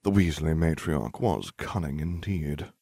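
usual speaking voice

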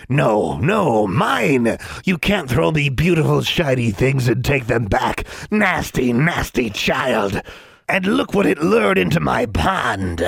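high, slow hissing creature rant; greedy swamp creature. Acted. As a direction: whine greedily like a goblin.

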